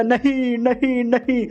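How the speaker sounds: high, drawn-out wail of disbelief; hammed-up dramatic cry of refusal; anguished denial. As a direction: stretch each no out high in mock despair. Acted cry of disbelief at disaster.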